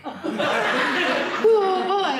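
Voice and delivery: high, loud startled why with a laugh; son's surprise. Retold or imitated. Teenage son on the phone, high startled question. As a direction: react shocked but amused. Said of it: "I said".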